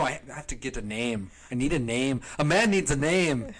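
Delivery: hammed-up Minnesotan insisting, rising to a peak; Minnesota accent. Singer demanding a name, mock Minnesota plea. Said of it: "goes into Minnesota and he's like".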